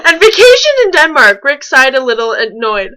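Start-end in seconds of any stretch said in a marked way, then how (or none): 0.1-1.4 loud, high laughing repeat; laughing shout. Real, not acted. Reader repeating the absurd line to her co-host, laughing shout.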